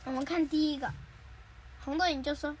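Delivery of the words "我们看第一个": usual speaking voice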